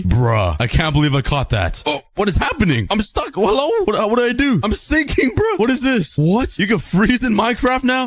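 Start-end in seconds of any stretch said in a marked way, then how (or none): 1.9-8.1 high, bewildered squeaks; disbelieving high-pitched alarm; shocked. Real, not acted. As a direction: panic as you sink. Stuck freezing in powder snow.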